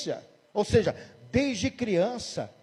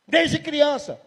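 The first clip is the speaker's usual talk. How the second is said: demon confirming; 6 semitones higher, much slower than usual. Retold or imitated. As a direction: state it back coldly. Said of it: "E o demônio". (confirmação do demônio, mais alta)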